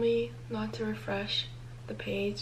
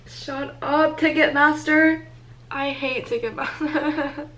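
high, loud venting with a laugh; exasperated anger; 5 semitones higher, 7 dB louder, slower than usual. Real, not acted. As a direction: tell off the ticket site. Lashes out at ticket site.

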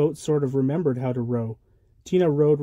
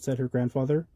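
plain reading voice

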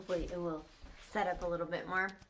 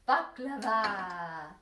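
drawn-out excited; 4 dB louder, much slower than usual. Real, not acted. Announcing the son's snack.

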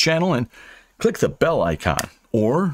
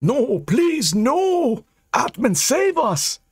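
high-pitched horrified plea; high voiced horror, not whispered; horror-struck; much slower than usual. A narrator's reading. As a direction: plead in dread, voice pitched way up. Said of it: "whispered to himself".

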